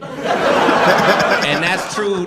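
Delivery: drawn out slowly through laughter; laughing slow punchline. Real, not acted. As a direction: stretch it out laughing.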